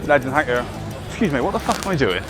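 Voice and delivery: ordinary delivery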